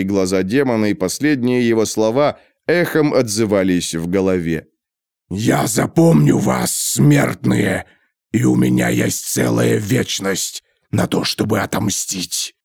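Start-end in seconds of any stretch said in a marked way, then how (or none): 5.3-12.5 slow menacing demon. A narrator's reading. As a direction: threaten like an ancient demon. Demon's parting threat.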